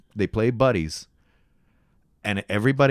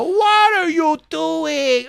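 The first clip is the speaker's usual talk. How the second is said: very high, slow, loud overacted line; hammed-up bad acting; 6 dB louder, much slower than usual. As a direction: mimic the worst actor. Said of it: "You're like".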